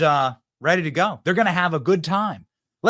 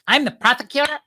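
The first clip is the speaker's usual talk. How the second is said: lightly put-on mocking impersonation, starting high; halting mock debate boast, pitched up at first; mock candidate voice; 4 semitones higher than usual. Host imitates Kamala Harris at the debate, mocking put-on voice.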